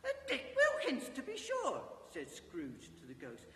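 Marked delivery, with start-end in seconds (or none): 0.0-1.8 much higher, laughing delight. Acted. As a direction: laugh with joyful recognition. Scrooge delighted at old friend.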